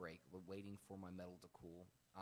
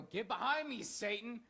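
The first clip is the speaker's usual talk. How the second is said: hammed-up high, loud yell; exaggerated shouted command; over-the-top rebuke; 12 dB louder, slower than usual. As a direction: bellow the rebuke far too hard. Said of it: "probably didn't say it like that".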